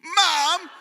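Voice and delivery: loud, high mock cry for Mom; hammed-up shout to mother; call for help. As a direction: yell like a tattling child. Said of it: "we're gonna call for help".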